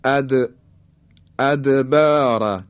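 اد with the د released in a bounce; slow ادبار, echoing the still د; qalqalah on dal. Shown as a demonstration. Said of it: "حرف دال".

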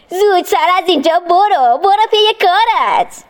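put-on sharp boyish dismissal; boy shooing away. A narrator's reading. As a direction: chase the devil off. Ismail drives devil away.

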